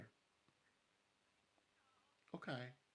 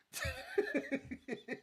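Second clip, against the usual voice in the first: high laughter. Real, not acted. Laughing at the Barbie game.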